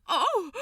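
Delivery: short high startled yelp; a startled little "Oh!"; alarmed yelp. A narrator's reading. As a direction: yelp in sudden fright. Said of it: "Aziraphale yelped in alarm".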